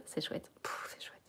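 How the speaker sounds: a breathless whisper; whispered aside. Real, not acted. Whispering an aside to viewers about the punching ball. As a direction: whisper it under your breath.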